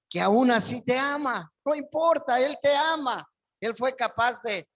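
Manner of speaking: loud, high, fervent preaching; preaching at full force. Acted. Pastor preaching to the congregation, loud and fervent. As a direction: proclaim it out to the congregation. Preacher proclaims God's love.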